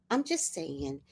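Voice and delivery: ordinary delivery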